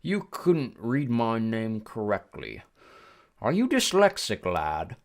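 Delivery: slow, probing British voice; British ferryman, pitch leaping on the question; British-like accent. A narrator's reading. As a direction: draw out the accusation in a British accent. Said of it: "He had a strange accent. British maybe".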